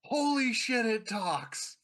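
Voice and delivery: a loud, high yelp of disbelief; shocked outburst. Acted. Patron hears the crow talk, loud shocked shout.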